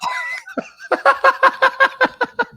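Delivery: high loud shrieking cackle; loud high-pitched laughter. Real, not acted. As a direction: burst into a squealing laugh. Cracking up at own story.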